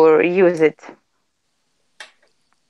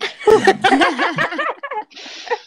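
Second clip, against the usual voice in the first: amused laugh; 11 semitones higher than usual. Real, not acted. Joked about not saying things.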